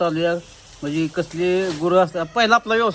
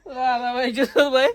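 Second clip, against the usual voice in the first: slow, higher, through laughter; laughing; 5 semitones higher, slower than usual. Real, not acted. Repeating the joke about Lalabai's daughter-in-law, laughing. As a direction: draw it out while giggling.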